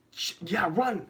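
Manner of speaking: high-pitched panicked go-ahead; urgent urging. Retold or imitated. Teller telling his friend to flee the crash, urgent high cry. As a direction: urge him to flee, voice jumping up.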